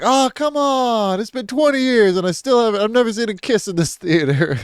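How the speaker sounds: hammed-up moviegoers begging for the kiss; crowd complaining. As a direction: groan it up high like a fed-up crowd. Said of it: "everybody's like".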